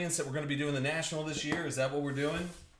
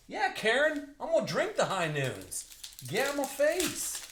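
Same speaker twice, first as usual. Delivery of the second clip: hammed-up sass snapping back at Karen, rising much higher on the last line; mock defiant retort; 9 semitones higher than usual. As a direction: pretend to tell off a nosy shopper, slowing the last line.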